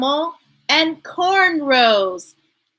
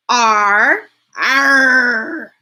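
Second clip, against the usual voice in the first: growling r; 4 dB louder than usual. Shown as a demonstration. Growled r sound.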